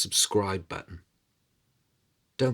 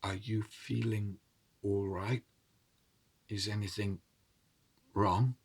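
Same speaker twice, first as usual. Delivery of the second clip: softer, gently worried question; hushed concern; 7 dB quieter than usual. Acted. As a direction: ask quietly at her side, afraid to alarm. Husband hushed to sick wife.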